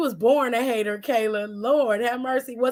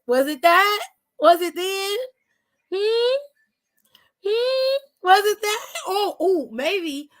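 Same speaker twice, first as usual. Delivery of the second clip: high, needling sing-song questions; mocking hums, pitched way up; taunting; 6 semitones higher, slower than usual. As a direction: keep poking at him.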